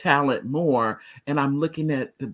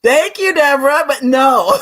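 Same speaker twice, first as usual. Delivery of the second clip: laughing hard, voice up high and loud; 9 dB louder than usual. Real, not acted. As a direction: crack up while reading it out. Laughing at Deborah's funny comment.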